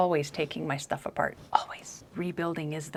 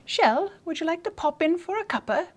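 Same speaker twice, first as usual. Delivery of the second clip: high, singsong mock British; British accent; 10 semitones higher, 10 dB louder than usual. Inviting the author for a cuppa, high hammed-up British accent. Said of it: "British accent".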